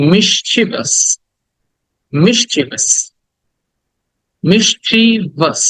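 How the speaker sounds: "mischievous" in three parts, MISH-chi-vus; correct pronunciation. Shown as a demonstration. Teacher models mischievous for the class, careful pronunciation. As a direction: say "mischievous" with no extra i before the ending. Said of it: "but উচ্চারণ হচ্ছে".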